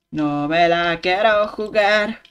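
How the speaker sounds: slow, spaced-out No me la quiero jugar; slow deliberate. Shown as a demonstration. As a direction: say it slowly and firmly. Voiced slow repeat.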